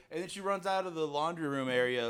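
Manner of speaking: ordinary talk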